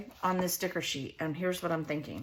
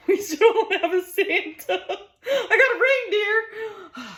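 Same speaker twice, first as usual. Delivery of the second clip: laughing through the words, much higher and louder; 13 semitones higher, 9 dB louder than usual. Real, not acted. Crafter laughing about having a reindeer but no Santa. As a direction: giggle it out, pitched way up.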